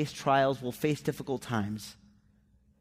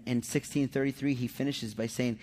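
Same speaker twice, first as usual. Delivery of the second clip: usual speaking voice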